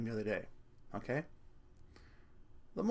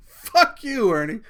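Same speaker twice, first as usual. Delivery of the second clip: loud, high retold outburst; shouted curse; 4 semitones higher, 9 dB louder, slower than usual. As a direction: act out suddenly cursing someone out, full volume. Acts out cursing toy in dream.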